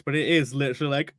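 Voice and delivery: usual speaking voice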